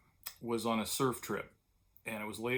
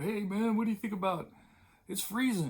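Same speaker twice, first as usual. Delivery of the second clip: higher, lightly voiced friend complaining of cold; imitating Bill; 9 semitones higher than usual. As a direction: play your shivering buddy, pitched up. Friend Bill quoted on cold morning.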